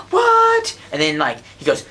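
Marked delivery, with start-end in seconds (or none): 0.1-0.8 a much higher, louder cry of disbelief; shocked crowd. Retold or imitated. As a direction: blurt it out like the whole crowd is stunned. Everyone gasps at the reveal.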